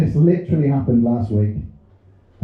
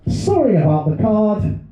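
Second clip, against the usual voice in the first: mum's card read out; 8 semitones higher than usual. Retold or imitated. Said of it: "and this is my mother".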